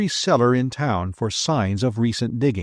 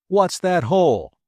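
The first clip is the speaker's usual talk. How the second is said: snapping; 7 semitones higher, 3 dB louder than usual. A narrator's reading. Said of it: "the trooper snapped at the owner".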